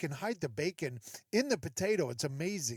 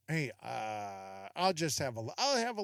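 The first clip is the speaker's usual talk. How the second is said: hesitant diner; 6 semitones lower than usual. Acted. Acting out hesitant dinner guest.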